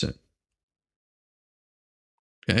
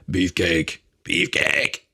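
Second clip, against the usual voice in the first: mock beefcake boast; 7 semitones lower, slower than usual. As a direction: lower voice, lightly hammed. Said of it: "he's showing the ladies, like".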